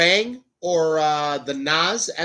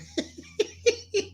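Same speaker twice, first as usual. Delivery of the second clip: high giggle; 4 dB quieter than usual. Real, not acted. Laughing at own forehead.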